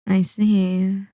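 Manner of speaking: ordinary talk, nothing marked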